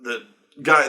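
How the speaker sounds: usual speaking voice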